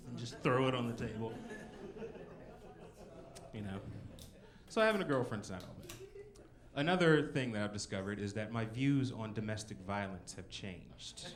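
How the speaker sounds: ordinary talk, nothing marked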